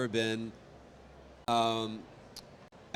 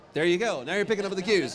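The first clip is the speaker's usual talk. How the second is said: pleased; 7 semitones higher, 6 dB louder, faster than usual. Real, not acted. Reacting to well-timed laughter.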